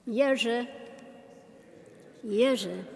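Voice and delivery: "Jerzy" said distinctly as YEH-zheh; phonetic pronunciation. Shown as a demonstration. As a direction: teach "Jerzy" with a Y start and a zh middle. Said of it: "the phonetic pronunciation".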